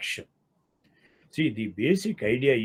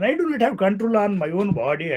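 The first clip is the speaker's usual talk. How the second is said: higher pitched, protesting tone with weight on do; mock indignation; 8 semitones higher than usual. Lecturer voicing a citizen's outrage at state control, mock indignant. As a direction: act out the citizen's moral outrage. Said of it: "I will have a sort of moral indignation".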